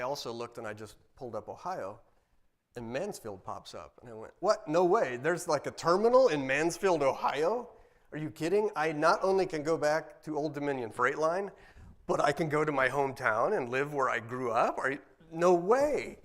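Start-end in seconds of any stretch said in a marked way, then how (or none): 4.4-16.2 loud, high, acted-out astonishment; amazed disbelief. Man discovering a job in his hometown, excited disbelief. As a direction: relive it incredulously, stretching the final exclamation.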